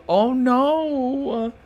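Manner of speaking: high, jumping "oh, no" in panic; the worry hits him all at once; sudden dread. Acted. Father-to-be realising his child may resent them, high alarmed cry.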